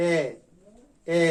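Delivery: এ said with lips spread wide; teacher showing the spread-lip এ; lips spread vowel. Shown as a demonstration. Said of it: "ঠোঁটকে প্রসারিত করে উচ্চারণটা করতে হবে".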